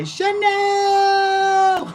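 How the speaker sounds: very high, sustained shout of Chanel; loud drawn-out yell. Acted. Calling out the name Chanel, long high shout. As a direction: scream the name on one long high note. Name then yelled as impression.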